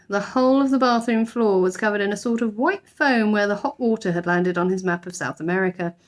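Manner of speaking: plain reading voice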